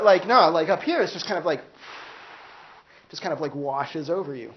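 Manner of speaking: normal talking voice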